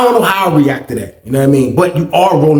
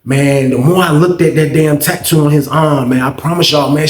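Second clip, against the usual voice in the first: ordinary talk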